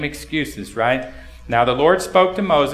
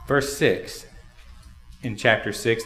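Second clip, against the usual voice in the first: ordinary delivery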